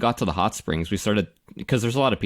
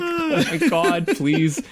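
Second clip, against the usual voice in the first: higher, laughing exasperated plea; mock pleading; 6 semitones higher than usual. Host begs the lady to stop, laughing mock plea. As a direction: beg through laughter. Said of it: "I'm like".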